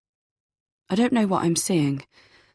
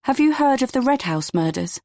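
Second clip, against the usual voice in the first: firm, raised-pitch question; 7 semitones higher than usual. A narrator's reading. Woman steels herself to confide in her date, firm. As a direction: ask it as firmly as you can. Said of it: "then say in my firmest voice".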